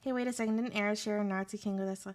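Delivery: usual speaking voice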